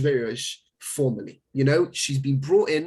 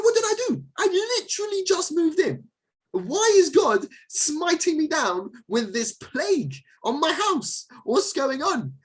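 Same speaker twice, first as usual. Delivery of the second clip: panicked new homeowner; 13 semitones higher than usual. Pretend. New arrival in Israel complaining to God, high mock panic. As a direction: wail it like a bewildered settler whose house just got cursed. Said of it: "And you're like".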